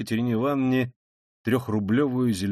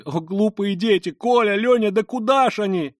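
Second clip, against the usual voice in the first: high, loud anguished calling; frightened cry; 9 semitones higher, 6 dB louder than usual. A narrator's reading. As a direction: wail after the fleeing children. Вскрикнула она вдруг в испуге. (испуганно, громко звать детей)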